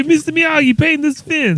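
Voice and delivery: very high-pitched mock New York kid; hammed-up high New York voice; Karate Kid impersonation. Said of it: "Do your Karate Kid impersonation".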